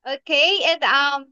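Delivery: ordinary talk, nothing marked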